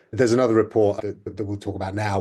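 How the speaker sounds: normal talking voice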